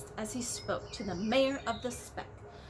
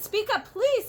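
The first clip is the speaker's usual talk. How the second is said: higher and louder, straining to be heard; calling out; 10 semitones higher, 8 dB louder than usual. A narrator's reading. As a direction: call down to the tiny voice. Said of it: "said Horton".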